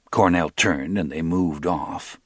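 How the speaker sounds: usual speaking voice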